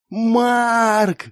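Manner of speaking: high, drawn-out cry of a name; distant shout. Acted. Someone calls Mark from the corridor's end, shouting. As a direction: call out from far down a corridor. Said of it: "закричал кто-то с другого конца коридора".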